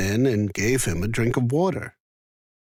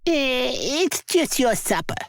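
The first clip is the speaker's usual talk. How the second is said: high dismissive wife's voice; giant's wife; 12 semitones higher than usual. A narrator's reading. Wife fobbing off the giant, high dismissive voice. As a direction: brush off the giant's worry. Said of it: "said his wife".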